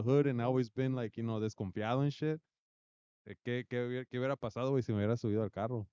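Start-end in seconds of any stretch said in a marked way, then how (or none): none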